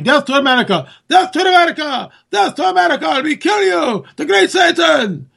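high-pitched mock rally chant; imitated crowd yelling. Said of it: "the crowd can be yelling".

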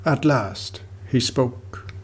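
ordinary delivery, nothing marked

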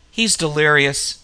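plain reading voice

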